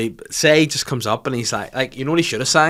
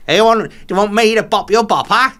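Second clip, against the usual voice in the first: Alan Carr impression; 7 dB louder than usual. Mocking Alan Carr at a [name] show, camp and loud. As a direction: ham up a camp greeting to kids. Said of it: "if Alan Carr was at, like, the, the kid".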